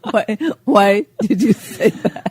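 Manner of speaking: ordinary talk, nothing marked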